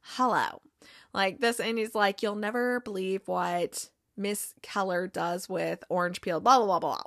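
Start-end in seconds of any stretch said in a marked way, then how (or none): 0.0-0.6 lightly voiced presidential impression, pitched low; a low hello with a hint of impersonation; Donald Trump. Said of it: "pretends that he's Donald Trump". 2.2-7.0 lightly voiced presidential impression, low and soft; a hint of a politician impersonation, pitched down; Donald Trump. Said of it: "pretends that he's Donald Trump".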